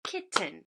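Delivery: KITTEN split into two separate beats, kit, ten; clapped syllables. Shown as a demonstration. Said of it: "clap it".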